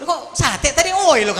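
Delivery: slow, laughing mock Madurese complaint; Madurese accent. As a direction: imitate a Madurese buyer, amused.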